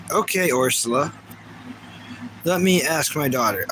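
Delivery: ordinary delivery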